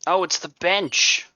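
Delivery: sudden realization. Real, not acted. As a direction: blurt it out slowly as it dawns on you. Suddenly realizes what the place is.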